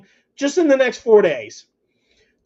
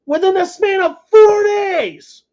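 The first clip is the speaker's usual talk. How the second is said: excited shout; 10 semitones higher than usual. Real, not acted. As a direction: yell it, can't believe the schedule. Hyped about packed sports week.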